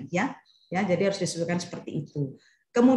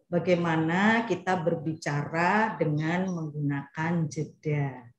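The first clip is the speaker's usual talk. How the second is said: slow down and let the pause land; 3 semitones lower, 4 dB quieter, slower than usual. Shown as a demonstration. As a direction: lower and softer, slow with a pause before the last word. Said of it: "ini saya mulai menyesuaikan nih".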